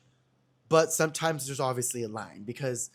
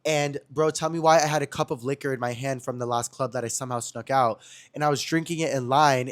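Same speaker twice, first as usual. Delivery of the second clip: ordinary talk, nothing marked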